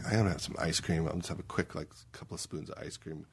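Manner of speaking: hushed, fading to an unvoiced whisper; sneaky inner voice. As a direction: whisper it like a guilty secret. Inner voice craving ice cream.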